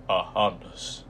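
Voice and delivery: loud, croaky ringmaster announcement; croaky showman voice. A narrator's reading. Mister Tall welcomes the audience to his freak show, loud and croaky. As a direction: proclaim it like an ancient circus master, loud. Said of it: "his voice was low and croaky".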